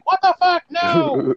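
very high, incredulous squeal; hammed-up indignant disbelief; mock outraged refusal. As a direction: voice leaping high in protest. Acted outraged refusal in retold quarrel.

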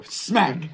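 loud, high yelled smack; mock smack sound. Retelling the slap scene, a loud yelled smack. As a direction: shout the slap like a sound effect.

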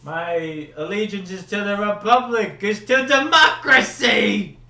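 high, loud theatrical cry building to a shout; mock-heroic shouted oath; hammed-up proclamation. Quotes a film line in performance.

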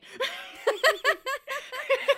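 loud high-pitched laugh; high burst of laughter. Real, not acted. Big laugh at own joke.

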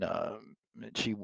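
ordinary delivery

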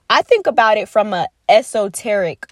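normal talking voice

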